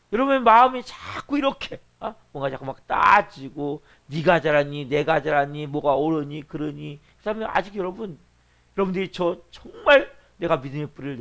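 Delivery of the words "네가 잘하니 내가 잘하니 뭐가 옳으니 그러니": low, monotone listing of petty quibbles; mock nitpicking. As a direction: recite the squabble in a low drone. Mimics petty nitpicking over who is right. (낮게 따지는 흉내)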